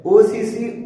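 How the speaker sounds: normal talking voice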